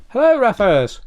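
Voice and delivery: ordinary talk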